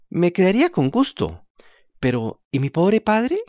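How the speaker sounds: high, grateful little puppet voice; a puppet thanking through drying tears; jerky creaky wooden puppet. Acted. Puppet worries about his father.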